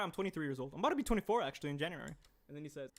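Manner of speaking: normal talking voice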